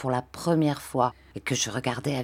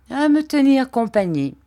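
put-on high, louder, wry old man; sly mocking reply; 7 semitones higher, 5 dB louder than usual. A narrator's reading. Old man teasing Barberin about the boy's use, sly and high. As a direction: answer with a knowing smirk.

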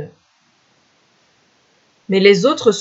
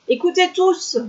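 mild mock cry; 11 semitones higher than usual. A narrator's reading. Hunter warning the villagers, high-pitched mild cry. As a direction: call out high, without real volume. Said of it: "cria-t-il".

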